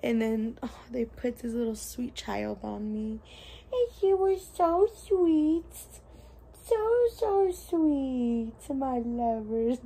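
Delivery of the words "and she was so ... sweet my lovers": high, slow cooing to a newborn. Real, not acted.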